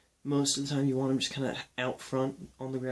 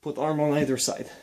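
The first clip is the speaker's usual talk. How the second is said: bad Russian accent; 7 dB louder than usual. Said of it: "This is a bad Russian accent".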